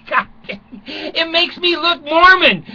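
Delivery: ordinary delivery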